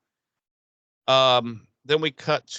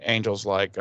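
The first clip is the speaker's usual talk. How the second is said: ordinary talk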